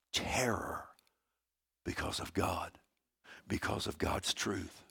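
very soft, near-whispered solemnity; hushed awe. Real, not acted. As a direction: drop to a hush and leave long pauses. Dramatic hush explaining demons' terror.

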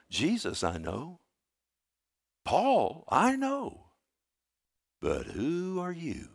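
slow, drawn-out taunting retelling; demon's challenge. Retold or imitated. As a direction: stretch each phrase, pausing between them. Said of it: "you know what the demon said?".

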